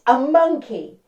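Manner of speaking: ordinary delivery, nothing marked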